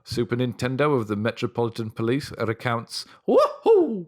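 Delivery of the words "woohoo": very high, hammed-up whoop; mock cheer. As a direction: squeal it out like a gleeful copper. Police chief mock cheer.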